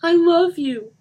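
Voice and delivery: high, loud repeat; insistent plea. A narrator's reading. Lisa insisting to Mark, pleading. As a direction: say it again, reaching for him. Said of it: "she repeated".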